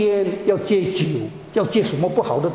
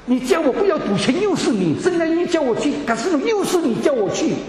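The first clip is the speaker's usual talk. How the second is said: incredulous protest; 6 semitones higher than usual. Retold or imitated. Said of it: "我说".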